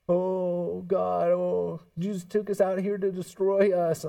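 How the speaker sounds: mock whiny complaint; grumbling Israelites. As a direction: whine like ungrateful wanderers.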